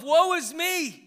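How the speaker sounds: loud, high, drawn-out cry of despair; passionate cry. Acted. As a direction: cry out in woe, stretching the words. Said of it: "It means a passionate cry of grief and, or despair".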